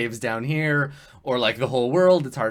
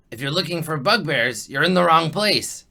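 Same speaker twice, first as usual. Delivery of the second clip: lightly voiced goblin, building louder; 4 semitones higher, 6 dB louder than usual. Goblin redirects the bugbear hunters, mildly forceful goblin voice. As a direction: point them elsewhere, emphatically. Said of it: "he says".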